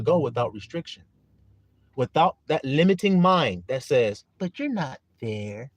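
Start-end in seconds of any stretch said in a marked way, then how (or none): 4.4-5.7 mock doubting voice. Voicing the limiting mind.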